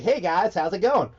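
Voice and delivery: high, bright American take; American accent. Shown as a demonstration. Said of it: "It has to be high pitch and fast".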